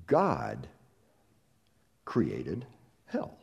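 "God" "created" "hell" each said alone with pauses; slow separated emphasis. Shown as a demonstration. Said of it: "Let that settle down on you".

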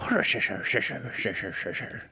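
mumbled attempt at Irish, fading away; bad Irish accent. Failing at Irish for the co-host, bad Irish accent. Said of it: "better Russian accent than Irish".

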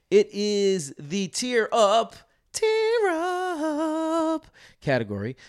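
big, slow, high announcer call. Acted. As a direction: boom it out like a game-show host. Announcing the segment title.

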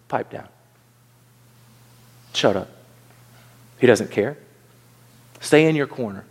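low, spaced-out commands; imitating a coldly dismissive crowd; stern put-down. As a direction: put him in his place, low and deliberate. Acts out crowd putting someone down.

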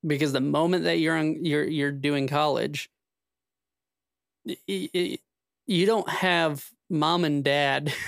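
ordinary delivery

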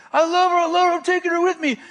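high, loud declaration; retold yelling. Pursuer yells at fleeing girl as he chases her, high urgent yelling. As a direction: call it out high and forceful. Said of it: "he keeps repeating".

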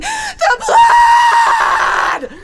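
piercing, drawn-out mock shriek; hysterical sister scream. Sister answers the call, hysterical hammed-up scream. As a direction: scream it as high and loud as possible. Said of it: "and she's like".

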